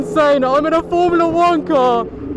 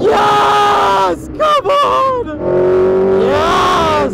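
high, loud shouts of triumph; elated shouts; 7 semitones higher, 4 dB louder, much slower than usual. Real, not acted. Driver cheering himself on in the car, loud triumphant shouts. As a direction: yell it like you just nailed the corner. Nailing a corner on track.

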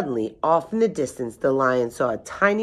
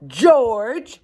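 high, loud screech of a name; 8 semitones higher, 6 dB louder than usual. A narrator's reading. Old woman calls out to her giant son, screeching. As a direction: screech it high, swooping upward. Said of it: "a high voice screeched".

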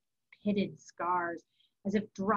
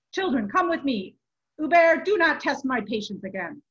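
loud, raised order; stern command; 4 semitones higher, 7 dB louder than usual. A narrator's reading. Monk summons children, warns abbot, stern command. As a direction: warn him off firmly.

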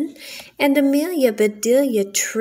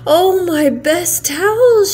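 dismayed; 8 semitones higher, 7 dB louder than usual. Acted. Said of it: "she said".

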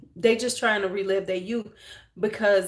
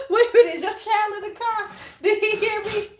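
sudden high, loud alarm; startled realization; 13 semitones higher, 5 dB louder than usual. Real, not acted. To a viewer whose child is in the car, startled high and loud. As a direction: jumps up in pitch, caught off guard.

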